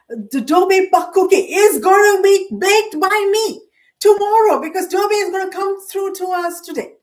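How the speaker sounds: loud, high rallying declaration; excited fan prediction. Real, not acted. Hockey fan rallying for the Montreal Canadiens, loud and excited.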